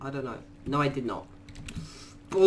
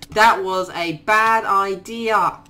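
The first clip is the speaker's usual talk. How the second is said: drawn out, slow, every word weighed; slow regret; 4 semitones higher, 7 dB louder, slower than usual. Real, not acted. Gamer regretting his move, slow rueful repeat. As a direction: repeat it slowly to let it sink in.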